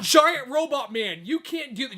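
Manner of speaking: high, drawn-out mock protest; mock yelling. Co-host acting a Chinese worker challenging RoboCop, mock yell. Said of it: "yelling at him in Chinese".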